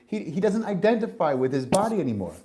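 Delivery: ordinary talk, nothing marked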